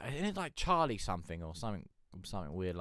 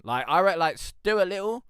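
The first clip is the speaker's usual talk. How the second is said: louder, higher, stretched out; excited; 10 semitones higher, 10 dB louder than usual. Real, not acted. Arguing who wins the fight, louder and higher. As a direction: push the point with rising excitement. Heated movie-fight debate.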